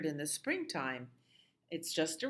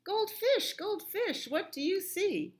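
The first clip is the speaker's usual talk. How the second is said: much higher and louder chanted refrain; loud sing-song refrain; 4 dB louder than usual. Acted. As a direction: call the refrain out high to the kids.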